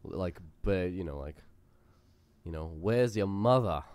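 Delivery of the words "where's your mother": put-on slow, drawn-out New England accent. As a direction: ironic Boston-style drawl. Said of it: "ironically do the New England accent".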